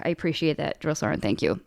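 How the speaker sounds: very soft, low thank-you; subdued retold thanks. Grieving trainee thanking her drill sergeant, subdued. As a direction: shrink the voice to a soft, low murmur.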